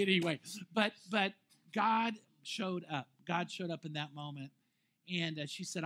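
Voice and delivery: ordinary talk, nothing marked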